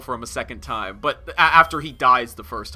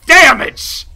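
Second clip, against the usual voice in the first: loud, high cheer; hyped battle whoop; battle cry; 11 semitones higher, 10 dB louder, much slower than usual. Retold or imitated. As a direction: shout it like charging in. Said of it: "I'm like".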